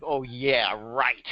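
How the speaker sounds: mildly sarcastic, under-played disbelief; eye-rolling sarcasm. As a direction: retell your own skeptical aside. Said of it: "I roll my eyes like".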